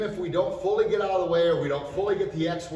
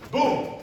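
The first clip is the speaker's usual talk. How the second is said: high, punched "boom"; impact sound; 8 semitones higher, 4 dB louder than usual. Shown as a demonstration. Teacher shows being tackled at the legs, sudden boom. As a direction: drop in a sudden boom for the takedown. Sound effect for takedown demo.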